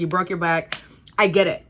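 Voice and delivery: ordinary talk, nothing marked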